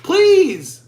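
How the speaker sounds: high, loud, drawn-out plea; put-on high-pitched "please"; hammed-up begging; mock plea. Acted quote, high and drawn out.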